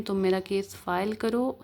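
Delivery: ordinary talk, nothing marked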